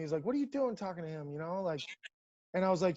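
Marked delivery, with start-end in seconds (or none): none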